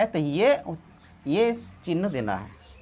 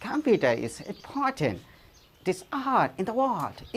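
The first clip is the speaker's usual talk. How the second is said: slow, soft mock American accent; 4 dB quieter, much slower than usual. Teacher imitates American speakers for the class, in a soft American accent. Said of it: "American लोग कहेंगे".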